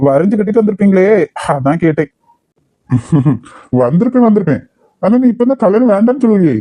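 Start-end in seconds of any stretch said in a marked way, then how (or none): 2.9-3.4 low amused chuckle; a quick laugh through the nose; short chuckle. Acted. Uncle chuckles at Abi's teasing, low amused chuckle.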